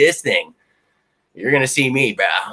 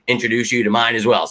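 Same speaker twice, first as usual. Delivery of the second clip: ordinary talk, nothing marked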